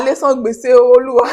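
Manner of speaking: loud, laughing through the words; can't stop laughing as you echo the prayer; laughing while praying. Real, not acted. Laughing retelling mom's prayer.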